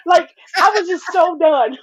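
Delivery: usual speaking voice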